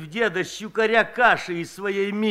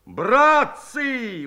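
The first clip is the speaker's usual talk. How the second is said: a higher exclamation of shock; stunned cry; 6 semitones higher, 4 dB louder than usual. A narrator's reading. Akim calls to the men, stunned and loud. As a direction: call out, struck dumb. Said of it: "воскликнул потрясённый Аким".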